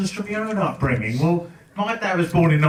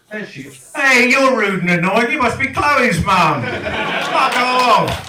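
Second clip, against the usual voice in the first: higher, louder mock-friendly greeting; fake-cheery greeting; 5 semitones higher, 4 dB louder, slower than usual. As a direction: breeze in all sweetness, then the sting. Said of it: "I was like".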